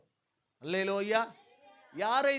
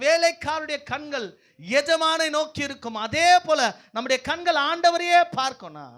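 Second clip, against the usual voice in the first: raised, loud preacher's voice; full-force preaching; 6 semitones higher, 10 dB louder than usual. Acted. Preacher urging the congregation to look to the Lord, full-force preaching. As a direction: drive the point home to a crowd, high and loud.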